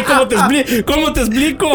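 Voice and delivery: high-pitched, stumbling into laughter; risa incontenible. Real, not acted. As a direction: repeat the question as you crack up. Retold stumbling reply, cracking up. (pregunta aguda que se rompe en carcajada)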